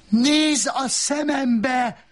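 slow, very high scream; drawn-out, high-pitched bellow; howling. A narrator's reading. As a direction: howl the command, stretching the words. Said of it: "üvöltötte".

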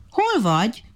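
monkey hailing from the other tree; calling out. A narrator's reading. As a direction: call over to someone lagging. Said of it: "a majom már kiabált a másik fától".

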